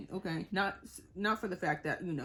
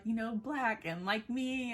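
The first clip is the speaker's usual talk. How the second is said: ordinary talk, nothing marked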